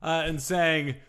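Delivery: ordinary delivery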